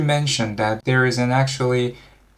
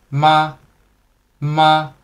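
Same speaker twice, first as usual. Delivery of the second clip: "ma" held level on a raised pitch; higher-pitched first tone; 4 semitones higher, 4 dB louder than usual. Shown as a demonstration. Teacher shows higher-pitched students the first tone, "ma" raised and level. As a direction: lift "ma" higher and keep it steady. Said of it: "even have a higher pitch".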